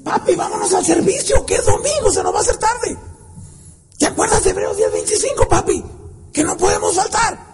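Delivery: high-pitched imitation of a little kid; a small child urging daddy along; pleading child. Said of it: "cuando el niño dice".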